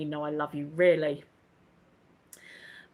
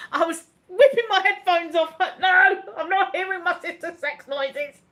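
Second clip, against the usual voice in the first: high, loud protest through laughter; laughing outburst; 9 semitones higher, 4 dB louder than usual. Real, not acted. Host recalling sister's embarrassing broadcast, loud laughing protest. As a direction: squeal it out, cracking up. Retelling horror, breaking into laughter.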